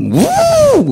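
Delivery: a sudden piercing high woo cry; high whoop. Retold or imitated. Recalling the sound heard in his head, a loud high whoop. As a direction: let out a shrill rising whoop, loud. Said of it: "Yun ang narinig ko".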